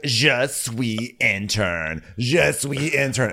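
put-on slow, clunky American-French accent; over-enunciate the French accent badly. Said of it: "not even trying to do a French accent".

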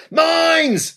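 high, sudden warning yell. A narrator's reading. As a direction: cry out the danger. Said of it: "yelled a voice".

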